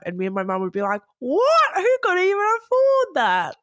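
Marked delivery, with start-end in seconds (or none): 1.2-3.6 very high, loud squeal of disbelief; hammed-up incredulous shriek; mock outraged shock. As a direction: re-enact being utterly floored by a price. Acts out her and mum's shock.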